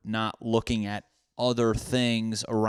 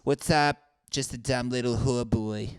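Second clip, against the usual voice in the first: higher put-on hooker greeting; 3 semitones higher than usual. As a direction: greet them in a mock hooker voice. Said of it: "any hookers that sound like that?".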